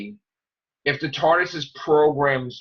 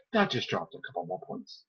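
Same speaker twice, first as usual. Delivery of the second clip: dry aside; 6 semitones lower, 11 dB quieter than usual. Retold or imitated. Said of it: "and I was like".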